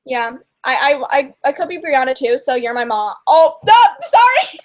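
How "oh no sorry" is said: high, loud, slowed "Oh, no, sorry!"; panicked apology, pitched up; horrified oops. Real, not acted. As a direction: caught out spoiling the show. Blurts a spoiler.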